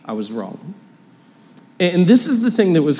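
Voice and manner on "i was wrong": soft apology. Retold or imitated. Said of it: "It was just".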